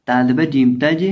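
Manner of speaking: ordinary talk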